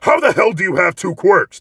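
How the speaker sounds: furious rival shouting across the classroom; angry yell. Acted. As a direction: explode at him in disbelief. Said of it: "Bakugo grows angry by this".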